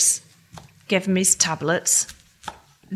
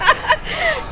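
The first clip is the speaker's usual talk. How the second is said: high laugh. Acted. Friends joke on picket line.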